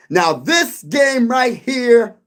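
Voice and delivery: slow, loud, high hype call; loud slow hype opener; hyped call-out. Real, not acted. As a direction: drag it out and shout it like an announcer. Hyping the next game.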